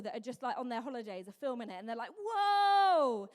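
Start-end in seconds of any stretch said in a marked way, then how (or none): 2.1-3.3 loud, high, drawn-out holler of amazement; hammed-up excited tourist shout; really excited whoa. Tourists filming buffalo on holiday, hammed-up excitement. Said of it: "they were really excited".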